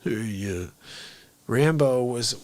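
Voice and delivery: ordinary talk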